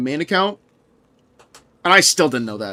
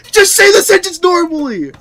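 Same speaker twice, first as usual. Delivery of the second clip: higher, louder words through a laugh; laughing exasperation; 11 semitones higher, 6 dB louder than usual. Real, not acted. Streamer scolding like-sayers to chat, higher laughing voice.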